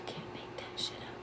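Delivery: unvoiced, hushed disbelief; whisper. Real, not acted. Streamer stunned by ghost app answer, whispered.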